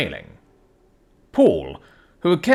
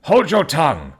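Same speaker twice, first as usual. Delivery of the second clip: sharp, louder, higher rebuke; ferocious; 6 semitones higher, 5 dB louder than usual. A narrator's reading. Butler silencing the weeping maid, fierce and on edge. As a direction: cut her off fiercely, nerves jangled. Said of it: "with a ferocity of accent".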